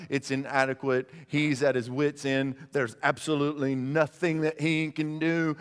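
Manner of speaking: ordinary talk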